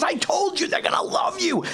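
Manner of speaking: high-pitched yell imitating an elated friend; excited I-told-you-so. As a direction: crow it, voice shooting way up. Retold friend's excited approval.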